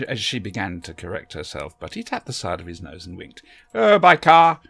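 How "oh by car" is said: higher, louder, breezy self-assurance; confident eccentric. A narrator's reading. The Doctor tells Barbara how he got there, breezy and sure, higher and louder. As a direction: toss it off with total confidence. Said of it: "he said confidently".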